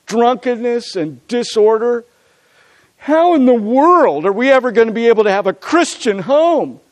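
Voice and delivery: high, loud, overwrought retelling of distress; hammed-up despairing plea; acted near-tears upset. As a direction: imitate an upset fiancée, pitched well up. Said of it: "Marty was just about in tears".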